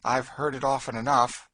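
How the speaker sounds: dry. A narrator's reading. Woman answers girl's fears about the noise, dryly. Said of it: "said Cassie dryly".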